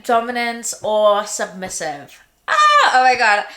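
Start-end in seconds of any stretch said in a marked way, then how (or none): 2.5-3.5 loud, startled gasp of embarrassment; flustered exclamation. Real, not acted. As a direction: blurt it out, caught off guard. Flustered by a sex question.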